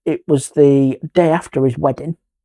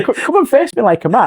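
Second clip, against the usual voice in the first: high, worked-up retold dare; angry challenge; 8 semitones higher than usual. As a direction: replay the dare with rising agitation. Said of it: "but I got angry".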